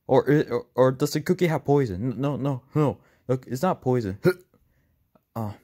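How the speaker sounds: ordinary delivery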